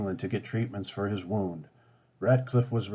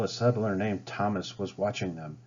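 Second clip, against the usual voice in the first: ordinary delivery, nothing marked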